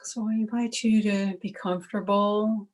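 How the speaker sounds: calm, even meditation voice with long pauses; guided meditation. Acted. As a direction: guide listeners gently into stillness, one unhurried phrase at a time.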